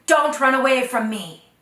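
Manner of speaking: loud, high call from afar. Acted. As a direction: call her back across the plain.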